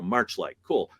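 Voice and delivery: ordinary talk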